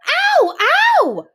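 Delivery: high, loud yelps; pained howls. A narrator's reading. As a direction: howl again and again in pain. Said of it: "he howled".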